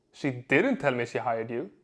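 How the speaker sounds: DIDN'T punched with a pitch peak; stress on DIDN'T. Shown as a demonstration. Coach showing how emphasis changes meaning, stress on "didn't". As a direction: hit the word "didn't". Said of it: "Same seven words, four completely different meanings".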